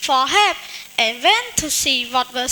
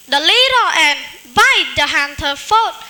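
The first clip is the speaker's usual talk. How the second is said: ordinary delivery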